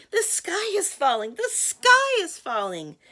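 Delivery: high, loud hen squawk, stretched at the end; clucked alarm. Acted. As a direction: cluck it in a frantic high cry. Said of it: "clucked Chicken Licken".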